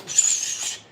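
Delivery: short hissed breath out, like a boxer throwing; sharp punch exhale. Shown as a demonstration. Showing viewers the breath on each punch, a sharp hiss. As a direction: exhale hard through the teeth on the punch.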